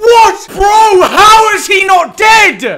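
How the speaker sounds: panicking gamer. Real, not acted. Disbelief at surviving enemy.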